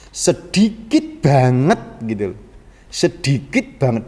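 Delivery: sedikit drawn out slowly and leaned on; slow, weighted sedikit with the stress on the first word. Shown as a demonstration. Preacher stressing that sedikit means very few. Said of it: "amat sangat sedikit atau sedikit banget".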